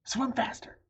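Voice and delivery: high, loud frantic urging; high loud panicked order. Acted. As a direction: urge her on in terror. Fleeing crocodiles.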